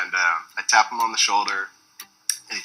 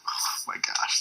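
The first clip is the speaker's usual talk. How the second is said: awed whisper; 8 dB quieter, slower than usual. Retold or imitated. Starstruck as Bill says goodbye.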